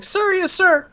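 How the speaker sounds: very high-pitched recruit voice; obedient cadet. Retold or imitated. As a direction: mimic a recruit's automatic military response. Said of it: "And he's like".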